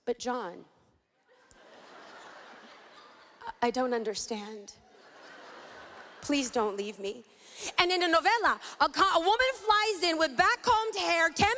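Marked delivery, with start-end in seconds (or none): 0.0-7.2 soft, lower, breathy soap-opera heroine pleading; hammed-up melodrama sigh to her lover; forlorn plea. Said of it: "look at her forlorn lover and say".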